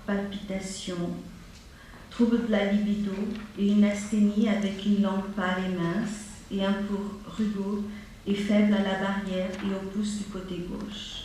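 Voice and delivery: usual speaking voice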